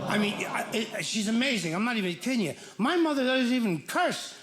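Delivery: normal talking voice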